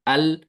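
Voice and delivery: अल with the tongue sliding a little off its spot; lam with slight slip. Shown as a demonstration. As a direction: say अल so the ल slips slightly. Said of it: "तरते हैं हमजा लाम जबर अल". (अल, ज़ुबान थोड़ी फिसलती हुई)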